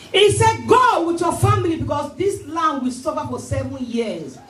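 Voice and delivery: high, fervent sermon call; preaching at full force. Acted. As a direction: rouse the church to repeat after you. Preaching the story at pitch.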